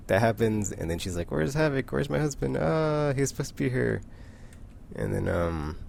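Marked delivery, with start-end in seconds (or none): 1.3-4.0 higher-pitched fretting for a missing husband; lightly acted worried cry, pitched up; mock worried wife. Wasp looking for her husband, worried.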